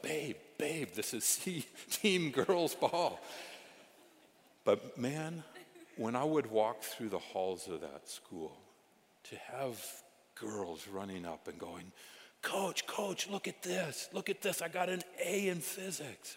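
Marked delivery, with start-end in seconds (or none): 0.0-3.2 mock scolding. Wife retorts to yelling husband. 12.4-16.4 put-on higher, eager kid voice calling out; excited schoolgirl. Girls running up excited.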